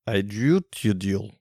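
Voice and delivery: lightly put-on Russian accent, slow; mock Russian accent. Owner says the dog's name so it sounds Russian, in a light Russian accent. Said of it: "Which sounds super Russian".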